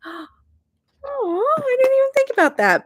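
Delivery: high, fast startled quote of herself; surprised gasp. Retold or imitated. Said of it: "And I'm just like".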